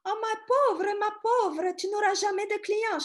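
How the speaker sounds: put-on French spoken in a high, loud Russian accent. Russian girlfriend teasing the new tour guide, in a high, loud Russian accent. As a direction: play the Russian girlfriend pitying her, with a thick Russian accent. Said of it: "She said with a Russian accent".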